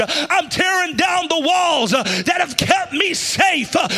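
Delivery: shouted prayer. Pretend. Preacher leading congregation in surrender prayer, loud fervent cry. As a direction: pour it out, breaking walls. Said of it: "say, God".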